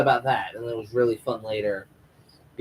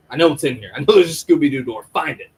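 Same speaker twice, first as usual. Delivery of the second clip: urgent, louder, rushed demand; mock frantic; 4 semitones higher, 7 dB louder, faster than usual. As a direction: push it out like you're going nuts. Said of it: "[name]'s just going nuts".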